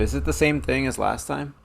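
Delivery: ordinary talk, nothing marked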